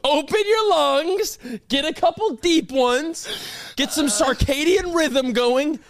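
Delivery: slow, high, drawn-out calming guide voice; mock meditation guide. Mocking his friend with a slow, high meditation-guide voice. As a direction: lead a breathing exercise in a hammed-up slow drawl. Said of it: "What's with you and all these ancient words?".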